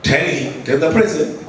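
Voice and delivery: usual speaking voice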